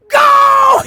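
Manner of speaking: high, loud shout. Retold or imitated. Stuntman retelling the cue to drive, loud shout. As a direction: yell it like a starting cue.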